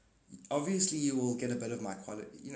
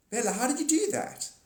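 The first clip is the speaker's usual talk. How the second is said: much higher, amazed "how did you do that"; mock amazed viewer; 12 semitones higher than usual. Viewers asking Bella about her powers, high amazed. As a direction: squeal it in disbelief. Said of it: "quoted viewers".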